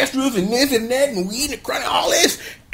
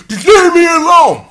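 high, loud anguished yell; mock outburst; 10 semitones higher, 10 dB louder than usual. Recapper as Naga snapping at his friends, mock anguished outburst. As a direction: burst out in hammed-up despair. Acted outburst after sad pleading.

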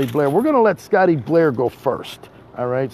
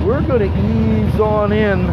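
slow, projected announcement; full-voiced, leisurely hype; drawn-out promo call; 7 semitones higher, 4 dB louder, slower than usual. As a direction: stretch it out in a big promo voice. Promo voice, then eased-off repeat.

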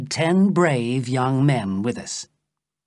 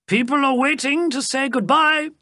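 much higher, louder calling voice from outside; Sapt calling; 12 semitones higher, 4 dB louder than usual. A narrator's reading. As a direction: call out from the garden, high and carrying.

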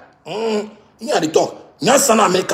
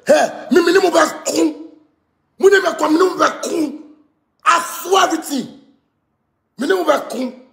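mock caller voice; 4 semitones higher than usual. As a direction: imitate him calling out. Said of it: "me catch him say".

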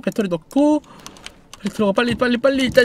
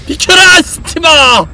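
shout; 5 semitones higher, 7 dB louder, much slower than usual. Real, not acted. Charging through the enemy.

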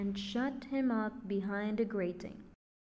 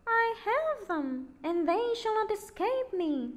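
triumphant witch; 11 semitones higher than usual. A narrator's reading. Witch gloating over the trapped children, triumphant high voice. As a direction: crow it like a witch who has caught her prey. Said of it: "said triumphantly".

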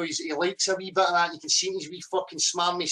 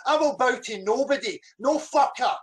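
angry outburst, raised pitch; angry defiance; 4 semitones higher than usual. Real, not acted. Man refusing to bow to his rival, furiously defiant.

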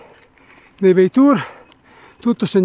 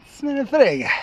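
viewers' sarcastic retort; 5 semitones higher than usual. Retold or imitated. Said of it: "e voi direte".

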